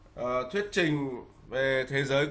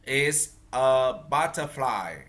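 slow model phrase; 2 semitones lower, slower than usual. Shown as a demonstration. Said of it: "Con đọc đi ạ".

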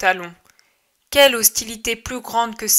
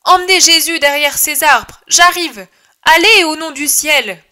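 higher, loud urgent shout; shouted orders; 6 semitones higher, 7 dB louder than usual. Acted. Judas shouting to John and Simon under stones, urgent loud orders. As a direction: bark the order over the stones. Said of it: "Il hurle à Jean et à Simon".

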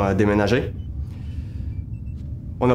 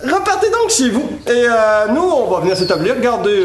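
high, nervous laughing babble; flustered, laughing it off; 11 semitones higher, 4 dB louder, slower than usual. Acted. Captain nervously placates God. (voix nerveuse et aiguë)

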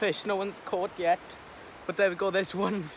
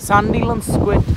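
loud, slow repeat; 5 semitones higher, 12 dB louder, slower than usual. Real, not acted. Repeats friend's answer loudly.

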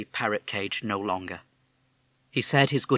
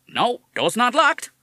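put-on higher, louder parrot with a tough American accent; 9 dB louder, slower than usual. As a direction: talk like a fast-talking American wise guy. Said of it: "You have an American accent".

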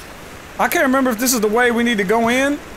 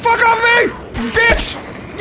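furious shouting; 12 semitones higher, much slower than usual. Real, not acted. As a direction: yell at the beast attacking you. Fighting off a cougar.

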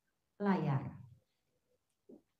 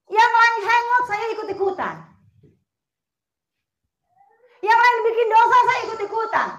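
loud and higher, hammering each line; preaching at full force; 12 semitones higher, 11 dB louder than usual. Real, not acted. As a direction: proclaim it to the congregation. Preacher proclaiming loudly.

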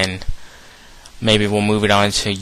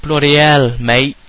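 Bad mock British, higher and louder; bad British accent; 5 semitones higher, 5 dB louder, slower than usual. Teasing his friend from England in a terrible British accent. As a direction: bark it like a cartoon Aussie-Brit. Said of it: "That was bad".

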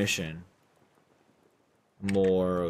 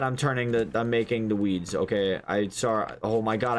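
ordinary talk, nothing marked

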